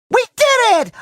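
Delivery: high-pitched triumphant cry; triumphant cheer. Acted. Hero celebrating a finished task, high and triumphant.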